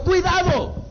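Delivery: high, drawn-up cry of warning; high-pitched acted warning; warning call. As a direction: call it out high, like sounding an alarm to your son. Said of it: "toca la trompeta y le dice a su hijo".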